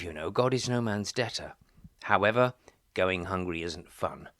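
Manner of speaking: ordinary talk, nothing marked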